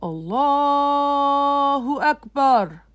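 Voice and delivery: very slow, loud and high chanted call; imam's call. Acted. The imam starting prayer, loud drawn-out call. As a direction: call it out long and resonant.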